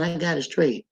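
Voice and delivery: ordinary talk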